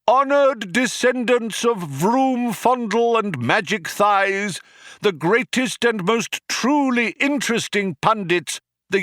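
high, loud, slow proclamation; crying out to crowd. A narrator's reading. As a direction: boom it out like a rally speaker. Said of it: "he cried out".

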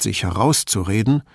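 ordinary talk

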